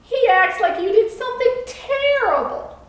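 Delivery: teasing, exaggerated dismay; mocking. A narrator's reading. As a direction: make fun of a worrier, pitch swooping up. Boat mocks the worried keeper.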